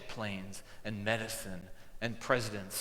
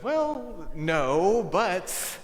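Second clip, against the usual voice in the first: amused surprised refusal; 7 semitones higher, 7 dB louder, much slower than usual. Real, not acted. Boy asks to be Jesus.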